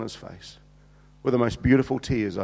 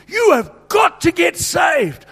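shouted altar call, high and slow; loud, slow preaching call to get saved; 12 semitones higher, 7 dB louder, slower than usual. Retold or imitated. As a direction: preach it out at full force to a crowd. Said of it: "I'm preaching to them".

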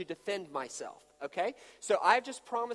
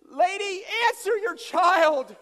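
imagined exasperated retort; 10 semitones higher, 7 dB louder, much slower than usual. Retold or imitated. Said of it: "wanted to turn around and say".

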